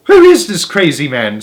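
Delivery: put-on old woman shrieking at a stranger; screaming. A narrator's reading. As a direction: grandmother screaming in outrage. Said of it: "screamed Grandma Josephine".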